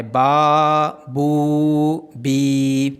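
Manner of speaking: با, بو, بی each held long; long vowel drill. Shown as a demonstration. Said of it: "یہ الف مدہ ہے، یہ واو مدہ ہے، یہ یا مدہ ہے".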